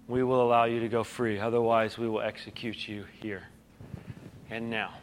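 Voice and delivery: dropped low and soft, a cold quiet threat; quiet menace. Retold or imitated. Official to kneeling believers, hushed cold threat. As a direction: let the threat sink to a hush.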